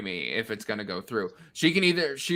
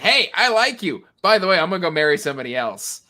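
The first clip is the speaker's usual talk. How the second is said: mock king; 5 semitones higher, 7 dB louder, faster than usual. Mocking the king's confession.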